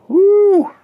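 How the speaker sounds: sudden high whoop of delight; excited whoop. Real, not acted. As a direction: yelp with glee. Surprise at found part.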